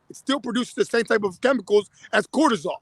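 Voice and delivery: normal talking voice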